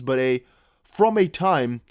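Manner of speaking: slow careful reading. Shown as a demonstration. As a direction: read it slowly to get it right. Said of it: "I have to read that a couple of times".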